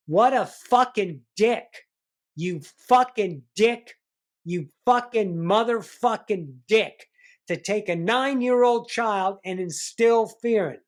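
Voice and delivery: loud, high, raging curses; furious outburst. Real, not acted. Speaker raging at his absent father, loud and furious. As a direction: spit the words out in real fury.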